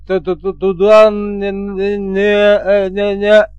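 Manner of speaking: heavy stutter on one held pitch; stuttering interpreter. A narrator's reading. Guard's interpreter answering his chief, badly stuttering. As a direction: stammer out each word, catching on the first sound. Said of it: "этого заикалистого переводчика".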